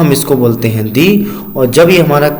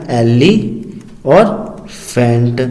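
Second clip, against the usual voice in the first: sounding out syllables; 3 semitones lower, much slower than usual. Shown as a demonstration. Teacher breaking a word into syllables for kids, slowly. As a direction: split elephant into एली and फेंट, the second part very slow. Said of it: "elephant कैसे लिखा जाएगा?".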